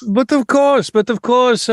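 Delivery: put-on French accent. Monet's stock phrase.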